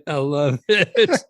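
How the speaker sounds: slow, drawn-out laughing delight. Real, not acted. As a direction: savour it while laughing. Repeats the line, drawn out and laughing.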